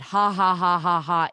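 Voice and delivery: loud, spoken-out fake laugh; mimicked scornful laugh. Mimicking friend's reply, loud fake laughing. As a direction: hit each ha hard and evenly. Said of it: "she, like, responded".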